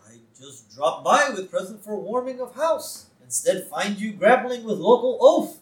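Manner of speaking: mock Russian statesman with a thick accent; Gorbachev. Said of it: "And so Gorbachev is there. He's like".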